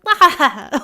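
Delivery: high mock startled "Ah!"; startled yelp. GM voicing cultists startled by the cloak, loud mock yelp.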